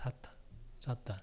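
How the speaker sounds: dropping to a near whisper; hushed repetition. Acted. Preacher stunning the congregation with Jesus' word, hushed.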